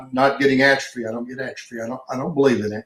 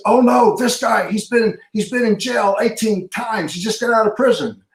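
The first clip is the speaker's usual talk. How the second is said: higher, worried alert; alarmed warning; 8 semitones higher than usual. Retold or imitated. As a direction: sound the alarm about the newcomer. Guy warns about ex-convict.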